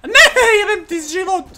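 laughing shout. Real, not acted. Laughing outburst at friend.

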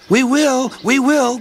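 put-on very high, eager child voices; children calling. A narrator's reading. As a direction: call back like excited children. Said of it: "called the children".

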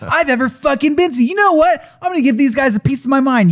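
hammed-up indignant rant; mock fury. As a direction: rant like a fed-up guest. Acting furious Rasputin.

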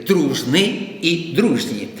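slow, separating дружний from дружній; careful contrast. Shown as a demonstration. Teacher stressing the difference to students, slow and deliberate. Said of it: "Підкреслюю".

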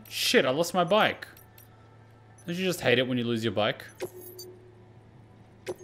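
ordinary talk, nothing marked

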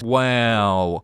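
ordinary talk

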